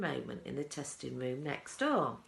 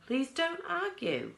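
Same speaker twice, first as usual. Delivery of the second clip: higher, louder exasperated plea; pleading cry; 10 semitones higher, 5 dB louder than usual. A narrator's reading. Wonka crying out at Mike to stop arguing. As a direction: beg them to stop arguing. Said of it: "cried Mister Wonka".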